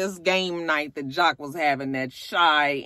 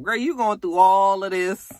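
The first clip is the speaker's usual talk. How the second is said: normal talking voice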